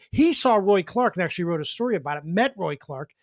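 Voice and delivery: usual speaking voice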